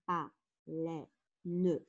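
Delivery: "Baleine" in three separate beats, ba-lei-ne; split into syllables. Shown as a demonstration. Teacher counting syllables for pupils, "Baleine" split as ba-lei-ne. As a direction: sound out "Baleine" with pauses, final e voiced. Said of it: "j'ai trois syllabes".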